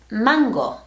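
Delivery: mango slowly, pure a vowels, MAN-go; Spanish mango. Shown as a demonstration. Teacher modelling Mango for students. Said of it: "the correct pronunciation is".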